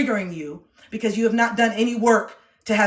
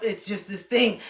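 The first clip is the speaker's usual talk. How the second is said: ordinary talk, nothing marked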